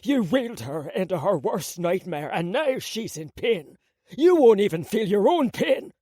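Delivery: furious father. Acted. Father blaming son furiously.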